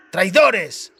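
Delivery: high, loud accusing yell; angry shout. A narrator's reading. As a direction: spit out the accusation in rage. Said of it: "gritó Dacre". (grito furioso de acusación)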